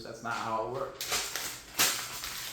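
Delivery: slow, low, soft scold; slow, quiet scolding of a coach; deadpan correction. Acted. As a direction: spell it out slowly like a lecture. Scolding Deion Sanders.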